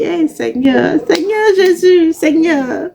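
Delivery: hammed-up wailing to the Lord; mock lament. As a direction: pretend to cry out to heaven in disbelief. Mock despair at his words.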